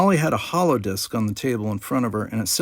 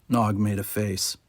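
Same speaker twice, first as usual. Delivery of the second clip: plain reading voice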